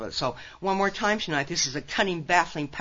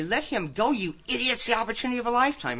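a held-in shriek, pitched up in exasperation; mock shriek; 6 semitones higher than usual. Grandmother imagining telling off her son over Olympic training, mock shriek. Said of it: "I wanted to shriek at him".

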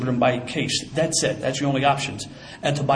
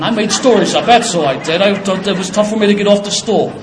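normal talking voice

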